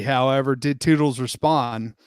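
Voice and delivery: ordinary delivery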